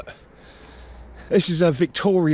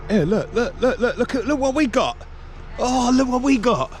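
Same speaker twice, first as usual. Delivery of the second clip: excited; 7 semitones higher than usual. Real, not acted. Finds old rails by fence.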